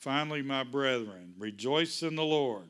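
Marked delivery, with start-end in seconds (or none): none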